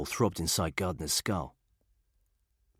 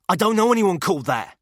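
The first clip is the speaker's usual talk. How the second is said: high, loud protesting cry; desperate denial; 13 semitones higher, 10 dB louder than usual. A narrator's reading. Frightened prisoner shouts back at his interrogator, high and loud.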